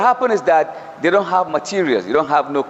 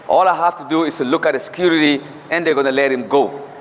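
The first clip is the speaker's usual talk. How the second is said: ordinary delivery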